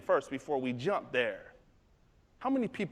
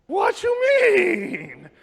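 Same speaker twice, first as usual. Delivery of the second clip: high, loud, very drawn-out wail; hammed-up bewildered cry; alarmed protest; 11 dB louder, much slower than usual. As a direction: stretch the question in disbelief.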